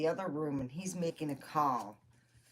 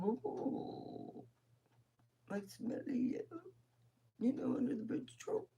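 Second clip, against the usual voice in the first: high, soft near-whisper; hushed retold query; 8 semitones higher, 7 dB quieter than usual. Answering the uncle about trolls, high and hushed. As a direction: ask it small and whispery.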